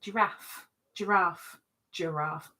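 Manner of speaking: giraffe with a soft zh start, ah vowel; giraffe, zhuh-RAHF; giraffe said her way. Shown as a demonstration. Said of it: "I say giraffe, not giraffe".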